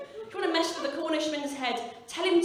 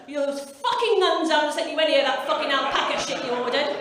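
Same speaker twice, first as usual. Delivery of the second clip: loud comic outrage; exasperated outburst; 2 semitones higher, 8 dB louder than usual. Acted. Shopper whose gifts have not arrived, loud exasperation. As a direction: rant indignantly at full volume. Shopper let down by nuns' late order.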